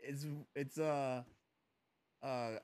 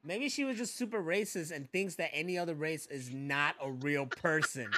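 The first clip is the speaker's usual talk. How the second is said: normal talking voice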